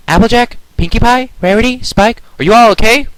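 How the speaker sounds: worried calling out. Acted. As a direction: search for friends by name. Twilight calls out after crash.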